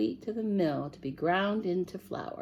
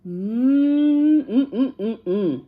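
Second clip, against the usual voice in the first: sniffing hum; 4 semitones higher, 7 dB louder, much slower than usual. Acted. Cat smelling cake.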